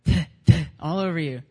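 loud, explosive bursts of "tuh"; imitated spitty speech. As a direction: imitate him spluttering hard as he talks. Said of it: "and he went".

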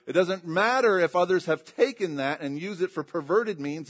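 fervent preaching, pushing hard; full-force sermon delivery. Acted. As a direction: preach it out to the congregation.

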